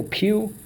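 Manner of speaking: PEW alone, high and isolated; single syllable. Shown as a demonstration. Teacher dictating the first syllable, said on its own. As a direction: give PEW as the first piece of puny. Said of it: "First syllable".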